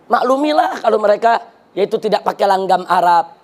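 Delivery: low-pitched, lightly acted excuse-maker; lightly voiced ministry official. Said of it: "ada lagi yang mengatakan begini dari kementerian".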